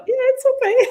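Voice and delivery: high, grudging mock reassurance; lightly voiced clerk saying it's fine. Jack imitating the stern clerk, lightly voiced and grudging.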